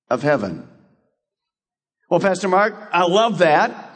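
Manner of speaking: ordinary talk, nothing marked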